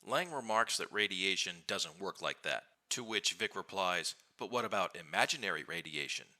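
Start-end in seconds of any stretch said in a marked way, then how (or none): 4.4-6.3 imaginary lifted in pitch; stress on imaginary. Retold or imitated. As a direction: lean on the word imaginary. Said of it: "Emphasis on the word imaginary".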